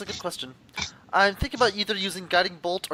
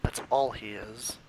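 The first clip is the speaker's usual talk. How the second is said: low, very soft and slow; whispered aside; 8 semitones lower, 11 dB quieter, slower than usual. Acted. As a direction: confide it under your breath. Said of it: "whispers to him".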